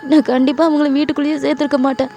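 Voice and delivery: high, distressed acted wail; upset, pleading self-defence; tearful protest. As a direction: protest helplessly as if crying. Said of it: "அப்படின்னு சொல்லிட்டு நீங்க ரொம்ப அழுவுறீங்க".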